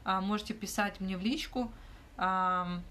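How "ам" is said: ordinary talk, nothing marked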